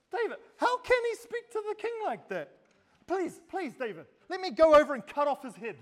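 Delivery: high-pitched, pleading outrage; indignant follower. Retold or imitated. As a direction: beg fiercely on the king's behalf. Acting indignant Abishai begging David.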